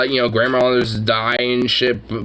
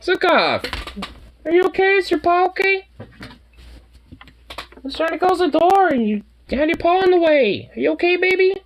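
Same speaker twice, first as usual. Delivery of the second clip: worried parent. Real, not acted. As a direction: fret over the hurt cat, pitched high. Checking the hurt cat.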